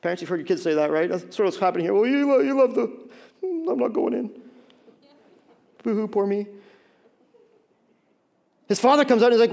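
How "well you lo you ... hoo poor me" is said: mock temper tantrum. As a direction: sulk like a kid feeling sorry for himself. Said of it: "little temper tantrum that sometimes we have".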